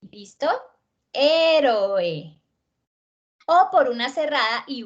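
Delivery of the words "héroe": "Héroe" stretched, strong first E, then falling O and E; hiatus demonstration. Shown as a demonstration. Teacher repeating the hiatus example, "Héroe" drawn out vowel by vowel. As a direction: hit the first vowel of "Héroe" and sound each one. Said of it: "representan más de un golpe de voz".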